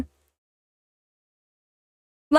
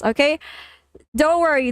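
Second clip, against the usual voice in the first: normal talking voice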